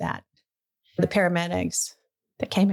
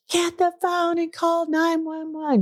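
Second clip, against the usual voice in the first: retold scream; 10 semitones higher than usual. Mother in the bathtub screaming at visiting sister, retold scream. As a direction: cry out an urgent order in a high held voice. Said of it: "she screamed".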